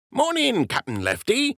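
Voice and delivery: high-voiced dockhand greeting his captain; Jimmy the docker's voice. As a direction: pitch it way up like a simple dock worker.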